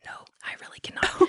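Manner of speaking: fast hushed protest; frantic whisper; whispered panic. Retold or imitated. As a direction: whisper it quickly, desperate.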